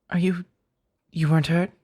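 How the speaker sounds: ordinary talk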